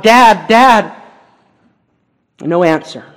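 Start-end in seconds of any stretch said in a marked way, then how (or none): 0.0-0.9 put-on child calling out for dad. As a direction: call out desperately for father. Child crying out to father.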